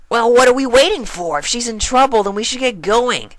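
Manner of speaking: rally friends to hurry; eager pony. Acted. Rainbow eager to go.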